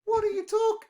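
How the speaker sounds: what on earth are you doing, exasperated; incredulous. Real, not acted. Incredulous at Ukraine tabloid jibes.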